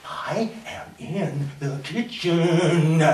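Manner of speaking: very slow, word by word; deliberate, drawn-out restraint; forced patience. Acted. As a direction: spell it out slowly at normal volume. Repeats answer with forced patience.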